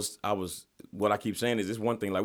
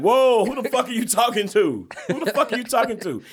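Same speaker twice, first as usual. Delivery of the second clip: half-laughing replay of yelling at him; retold outraged yell; 13 semitones higher, 8 dB louder than usual.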